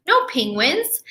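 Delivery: usual speaking voice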